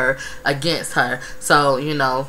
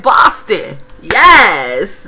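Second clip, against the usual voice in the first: mock excited; 2 semitones higher, 7 dB louder, much slower than usual. Vlogger mimicking a contestant guessing the city, mock excited. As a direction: imitate her thrilled, sure guess. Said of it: "all excited and shit".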